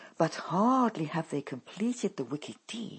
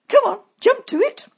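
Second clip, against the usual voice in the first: much higher, loud and fast bossy mother; impatient command; 16 dB louder, faster than usual. A narrator's reading. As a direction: snap her into action. Mother hurries Dolly.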